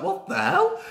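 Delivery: high incredulous what the hell; baffled crowd reaction; shocked. Retold or imitated. Crowd shocked by the surprise entrance, high incredulous. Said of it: "Everyone's like".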